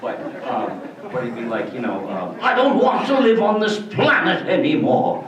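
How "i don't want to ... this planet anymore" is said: put-on much higher, louder rickety old man; weary ancient professor giving up; doddering old professor. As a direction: quaver like a hundred-year-old scientist. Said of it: "sounds like an old guy".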